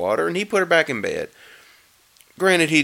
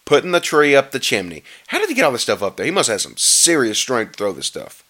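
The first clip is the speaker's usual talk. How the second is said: ordinary delivery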